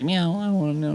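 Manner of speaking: high mock meow; imitating a cat. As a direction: play the cat asking to climb up. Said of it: "they make a sound like".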